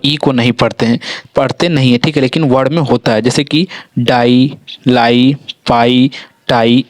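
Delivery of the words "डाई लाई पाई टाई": डाई, लाई, पाई, टाई slowly, each ending in long "ai"; teacher's slow word-by-word reading; pronunciation demo. Shown as a demonstration. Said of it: "इस प्रकार से इसका उच्चारण है".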